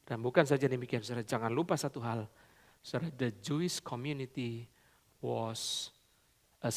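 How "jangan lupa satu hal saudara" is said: usual speaking voice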